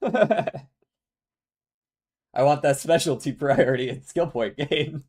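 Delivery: giggling through the words; laughing. Real, not acted. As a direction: laugh while saying it. Laughs while wanting both.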